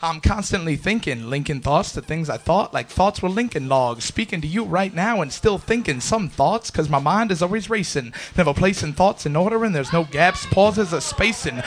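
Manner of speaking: driving, rhythmic slam poem with punched words; spoken-word poetry. Acted. Poet describing a racing mind, driving spoken-word performance. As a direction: perform it as relentless rhymed verse.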